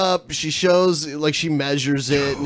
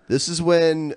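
ordinary talk, nothing marked

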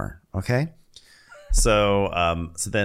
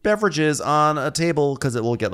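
scolding; 6 semitones higher than usual. Retold or imitated. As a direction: lay down the rules, emphatically. Voicing a woman's scolding rules.

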